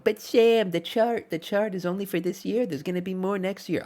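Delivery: high whiny complaining fan. Retold or imitated.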